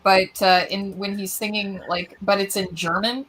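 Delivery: usual speaking voice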